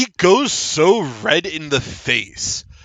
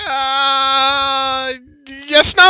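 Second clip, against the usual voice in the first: long stalling noise, then high loud blurt; flustered halfling; 10 semitones higher, 5 dB louder, much slower than usual. Acted. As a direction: blurt a panicked, unsure answer. Halfling squirms under questioning.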